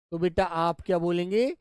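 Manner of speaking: ordinary talk, nothing marked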